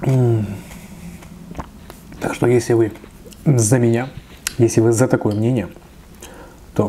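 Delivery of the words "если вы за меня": ordinary delivery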